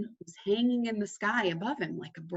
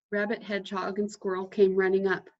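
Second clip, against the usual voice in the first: plain reading voice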